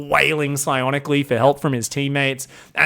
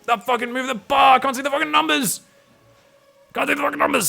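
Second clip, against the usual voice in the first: higher, whiny mock complaint; mock commenter rant; 10 semitones higher, faster than usual. Caster voicing annoyed viewers about the bar, high mock whine. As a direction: whine like an angry YouTube commenter. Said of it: "Be like".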